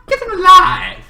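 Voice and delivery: hammed-up high shout; high mock cry. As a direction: squeal the line out. Repeats high and loud.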